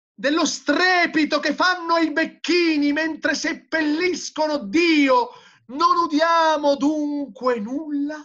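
high, loud theatrical questioning; impassioned declamation. A narrator's reading. Madman hearing gravediggers bury God, high loud declamation. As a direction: hurl each question at the crowd. Madman's tirade read aloud.

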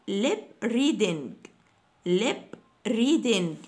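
lip reading said very slowly; slow model. Shown as a demonstration. Teacher slowing down the phrase for students. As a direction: draw out every sound. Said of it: "teacher models the phrase".

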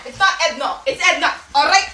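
ordinary delivery

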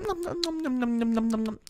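soft cute nom-nom chewing; munch greedily like a baby. Acted. Şimşek Bebek gobbling the food, soft baby munching.

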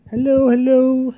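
high, loud, drawn-out hello; calling into a mic. Acted. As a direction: sing it out like a mic check. Mimes calling into the microphone.

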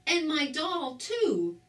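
high, upset cry on "my doll"; a mild storybook wail. A narrator's reading. Harry finds his doll raked away, mildly wailing. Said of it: "cried Harry".

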